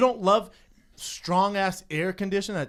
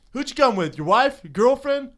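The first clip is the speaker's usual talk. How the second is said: higher, louder mock hostess grilling him; mock teasing host; 7 semitones higher, 10 dB louder than usual. Comedian imitates the host grilling the guy, higher and louder. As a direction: needle him like a cheeky host. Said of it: "And then she goes".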